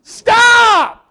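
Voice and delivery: high, loud drawn-out yell; outraged donkey. Acted. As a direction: shout it as a beaten donkey protesting. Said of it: "the donkey is like".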